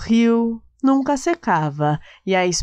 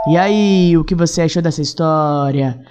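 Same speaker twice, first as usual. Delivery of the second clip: louder upbeat sign-off; bright friendly podcast host; cheerful podcast outro; 5 dB louder than usual. Real, not acted.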